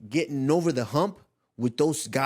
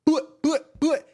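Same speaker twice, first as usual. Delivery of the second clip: high, loud "But, but" like a dancehall horn; Jamaican sound effect; 13 semitones higher, 6 dB louder, much slower than usual. Pretend. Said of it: "I gotta do sound effects".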